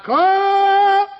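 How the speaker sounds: high, loud, slow desperate cry; trapped pilot yelling to his friend; hollering for help. Retold or imitated. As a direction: scream for rescue from the wreck. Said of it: "he hollered out".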